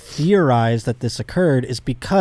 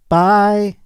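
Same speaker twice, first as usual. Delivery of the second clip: mock Taylor voice; 5 semitones higher, slower than usual. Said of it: "and then she goes".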